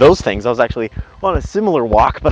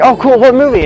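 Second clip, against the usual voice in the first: high, loud, eager question; mock-excited, pitched right up; retold excitement; 12 semitones higher, 8 dB louder than usual. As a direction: imitate a date perking up at the invitation. Retold eager reply to movie invitation.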